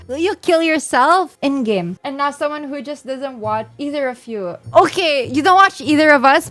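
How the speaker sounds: ordinary talk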